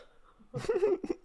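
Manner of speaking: sudden high laugh bursting out; burst of laughter. Real, not acted. Host cracking up at guest's word sus, real laugh. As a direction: crack up at the answer.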